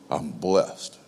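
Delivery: preacher mocks the stock churchy answer, flat and low; dull rote answer. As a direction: toss it off by rote. Acted greeting, dull answer.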